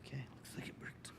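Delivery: very quiet, near-whispered aside; quick, barely voiced murmur to oneself; muttered to self. Real, not acted. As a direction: whisper it under your breath while checking. Checking the stream before starting.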